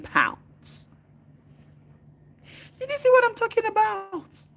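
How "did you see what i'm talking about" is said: high, loud indignant cry; exasperated outcry. Real, not acted. Demanding viewers see her point, loud and high. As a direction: throw the question out loud and high. Heated political rant.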